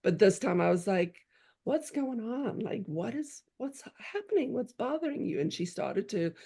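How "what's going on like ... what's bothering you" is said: soft, higher-pitched concerned questions; tender retold mother's asking; gentle coaxing. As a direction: coax an upset child to open up. Retells gently asking upset daughter.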